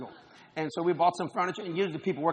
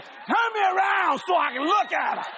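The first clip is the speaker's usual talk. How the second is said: put-on old man ogling; 11 semitones higher, 5 dB louder, slower than usual. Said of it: "he said".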